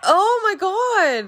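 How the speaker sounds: loud, high, stretched exclamation; drawn-out exclamation. Real, not acted. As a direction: wail it out slowly in disbelief. Reacts to enemy in game.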